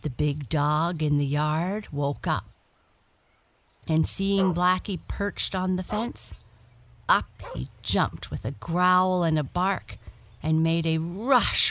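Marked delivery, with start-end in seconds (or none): none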